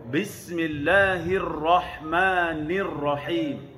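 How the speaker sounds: Bismillahirrahmanirrahim recited very slowly, every letter held; teacher's slow recitation demo. Shown as a demonstration. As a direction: chant Bismillahirrahmanirrahim slowly for the class. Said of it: "tengok saya".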